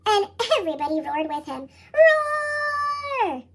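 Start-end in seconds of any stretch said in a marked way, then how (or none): 1.9-3.4 high shouted playful roar; group roar. A narrator's reading. Friends joining Froggy's dinosaur game, shouted roar. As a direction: everyone roaring like dinosaurs.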